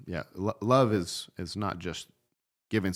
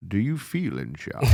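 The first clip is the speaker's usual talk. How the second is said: slow, louder menacing villain line, drawn out; mock-menacing villain, slow and a bit louder; movie villain quote; 2 semitones higher, 6 dB louder, much slower than usual. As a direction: drag it out like a big movie bad guy. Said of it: "what's a good enemy sign off".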